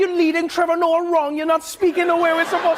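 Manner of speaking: high-pitched Trini voice; Trinidadian accent. Retold or imitated. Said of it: "They're like".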